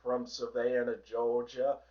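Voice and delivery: Savannah-style southern accent on "Georgia"; low, soft Georgia southern accent. Shown as a demonstration. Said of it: "If I dial up the southern accent".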